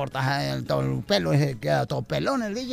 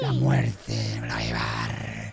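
Death's voice; 10 semitones lower than usual. Acted. Death searching the restaurant for the long-haired one, deep. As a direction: growl it out like the Grim Reaper. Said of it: "no encontraba al greñudo".

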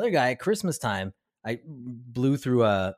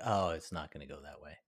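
much lower, much softer resigned aside; deflated letdown; 11 semitones lower, 12 dB quieter than usual. Retold or imitated. Realising the cop stop won't go that way, low and soft. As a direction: let the fantasy die quietly. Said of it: "immediately I'm like".

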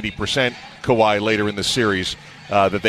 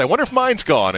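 curious wondering; 9 semitones higher than usual. Retold or imitated. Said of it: "and I said".

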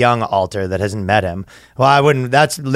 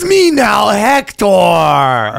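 higher, grander put-on voice; mock Hector voice; 7 semitones higher, much slower than usual. Comedian voicing the alter Hector taking over, grand and hammy. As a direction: announce yourself as the takeover alter. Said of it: "it starts out".

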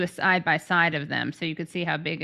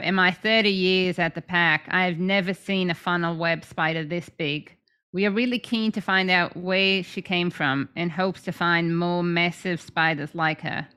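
a fair Australian accent, a touch put on; Australian education officer reading a statement; lightly done Australian accent. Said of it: "That's a good Aussie accent".

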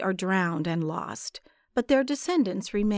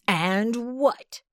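demanding; 2 semitones higher than usual. A narrator's reading. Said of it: "demanded Bospo".

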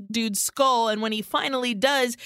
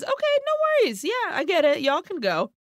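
fake-friendly high voice; 8 semitones higher, faster than usual. Villain feigns friendly release.

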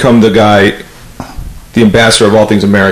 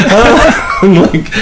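laughing; 9 semitones higher than usual. Real, not acted. Laughing at his own story.

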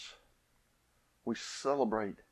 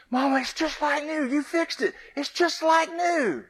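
put-on much higher, louder joyful child; delighted little girl; 6 dB louder than usual. Scene: little girl gets her shoe back like new, high and joyful. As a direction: gush like a thrilled little girl. Overjoyed child thanking mama.

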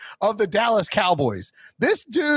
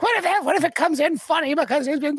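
worried wife quote; 9 semitones higher than usual. Retold or imitated. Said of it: "She's like".

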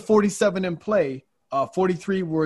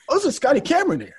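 high, excited imitation of an old clerk; old clerk spotting a prized putter, high and thrilled; impressed old man; 11 semitones higher, 3 dB louder than usual. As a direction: voice him delighted at the Scotty Cameron. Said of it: "And he said".

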